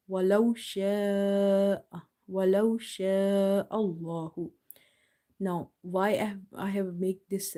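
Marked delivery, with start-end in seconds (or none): 0.1-4.5 slow recitation with sha'a held very long; stretched madd. Shown as a demonstration. Teacher shows the alif stretch, long-held recitation. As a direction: sustain the aa of sha'a on a level note. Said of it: "you will stretch up to two and a half alif".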